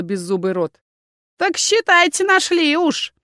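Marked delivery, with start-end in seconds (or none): 1.4-3.1 much higher crone voice; cheerful old hag announcing. A narrator's reading. Crone telling visitors they found it, high.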